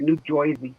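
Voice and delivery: New Jersey said Noo Joisey; New Jersey accent. Shown as a demonstration. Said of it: "That's how they say it".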